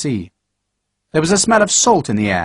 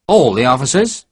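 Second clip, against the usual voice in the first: indignant. Acted. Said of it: "Sharp said indignantly".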